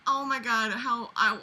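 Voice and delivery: ordinary talk, nothing marked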